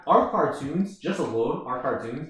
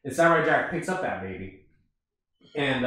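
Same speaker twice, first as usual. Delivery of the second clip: ordinary talk, nothing marked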